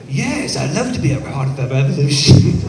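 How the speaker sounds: half-laughing, bad British accent imitation; [name]'s British accent. Imitating the British man he met on the plane, with a butchered British accent. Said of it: "I'm gonna try to do his accent, and I'm gonna butcher it".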